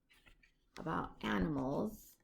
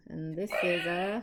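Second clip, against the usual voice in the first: plain reading voice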